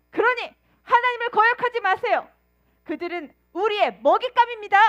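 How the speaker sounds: high, loud, bold rallying cry; confident proclamation. Retold or imitated. Joshua and Caleb urging the people, loud and bold. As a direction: rouse the crowd with faith. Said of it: "전혀 다른 보고를 하죠".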